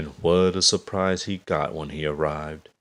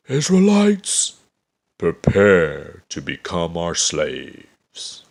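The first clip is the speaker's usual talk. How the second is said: almighty roar; 6 dB louder, slower than usual. A narrator's reading. Goliath threatening the Israelites, almighty slow roar. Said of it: "gave an almighty roar".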